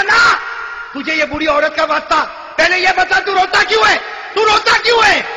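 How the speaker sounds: put-on high, loud, begging cry of an old mother; old mother begging her son to say why he weeps, crying out; pleading shout. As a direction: shout it as an anguished plea.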